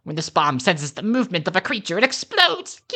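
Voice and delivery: building to a high-pitched finish; excited cartoon voice rising sharply; gleeful inventor. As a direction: present it with mad-inventor glee. Inventor presents the bomb.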